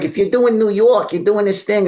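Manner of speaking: high, exaggerated New York accent; broad New York caricature; cartoony New York accent. Shown as a demonstration. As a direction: ham up a cartoon New Yorker. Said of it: "it's kinda cartoony".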